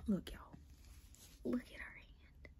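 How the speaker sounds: hushed whisper with a gasp; breathy amazed whisper; awed whisper. Real, not acted. Showing doll's veins to viewers.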